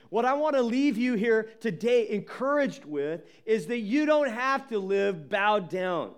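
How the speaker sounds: ordinary talk, nothing marked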